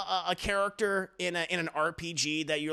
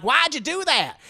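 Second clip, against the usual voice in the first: put-on southern accent; 9 semitones higher, 7 dB louder than usual. Giancarlo Esposito asks the killer why, in southern accent. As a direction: ask it like a shocked southern man. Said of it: "in his southern accent".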